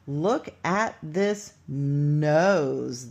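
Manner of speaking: slow reading. Shown as a demonstration. Teacher rereads sentence slowly.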